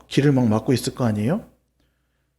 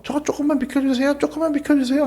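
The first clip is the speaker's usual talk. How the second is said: pleading; 11 semitones higher than usual. Acted. Pushing through crowd pleading.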